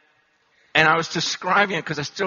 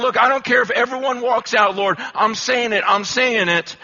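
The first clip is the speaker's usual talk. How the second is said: loud, high, bold and unbending; loud preacher's defiance; defiant declaration; 6 semitones higher, 5 dB louder than usual. Retold or imitated. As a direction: plant your feet and declare it. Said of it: "And I'm going".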